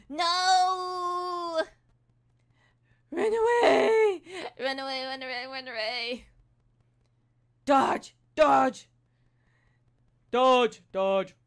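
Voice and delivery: high strained cries of run away; panicked gamer shouting, voice cracking; test screams. Shown as a demonstration. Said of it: "we're gonna do the same screams".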